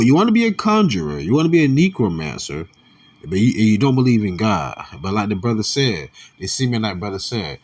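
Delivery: ordinary talk, nothing marked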